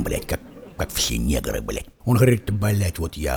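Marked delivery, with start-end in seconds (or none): 0.0-1.9 deep bass aside. Retold or imitated.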